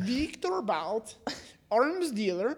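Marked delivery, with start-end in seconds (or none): 0.0-1.1 put-on slow, heavy Russian accent on the name. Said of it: "I said his name in a Russian accent".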